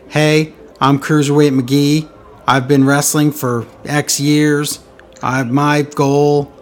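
slow, monotone promo parody; mock boring wrestler. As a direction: drag it out like a dull introduction video. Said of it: "those boring Cruiserweight Guys videos".

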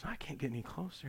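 very soft quoted reply; hushed retold admission. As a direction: repeat it quietly, as if stuck. Retold repeat of being stuck.

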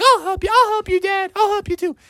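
high eager cub voices; eager kids. Acted. The cubs offer to help Dad push, high and eager. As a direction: chirp it like little kids. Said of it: "the kids were like".

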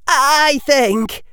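high, wavering, drawn out; faltering high-pitched start. A narrator's reading. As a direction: force it out in a high, unsteady voice. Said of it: "his voice still wavering but louder".

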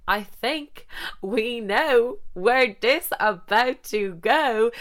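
slow, knowing, high sly drawl; knowing suggestive tone. Retold or imitated. To Sophie on spotting the French boys, slow knowing tease. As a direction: tease it like you've spotted your chance.

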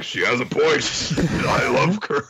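lightly voiced creature, laughing; bug voice. Bug agreeing about curry, lightly voiced and laughing. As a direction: chirp it like a happy critter. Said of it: "Bug is like".

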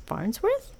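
rising high Farnsworth, unsure of the name; puzzled high question. Real, not acted.

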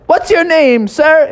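high, slow puzzled lifeguard voice; suspicious lifeguard. Acted. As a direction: eye the frog with mild suspicion. Lifeguard questions disguise.

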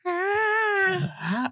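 high, drawn-out creepy groan; creepy moan. Retold or imitated. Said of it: "When he, like".

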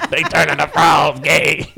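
slow, low mock-rant shout; Alex Jones. Podcaster parodying Alex Jones for laughing co-hosts, deep drawn-out shout. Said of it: "Alex Jones, you doing all that".

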